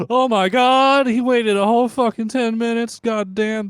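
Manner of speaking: high, loud sarcastic outrage; over-the-top mock horror; mock amazement. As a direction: gasp it out in exaggerated shock at something trivial. Mocking a dull shopping story.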